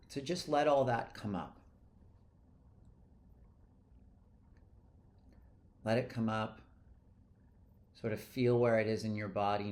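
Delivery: unhurried guide with long pauses; guided meditation. Real, not acted. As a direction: let each short instruction settle before the next. Guides listeners to let it go.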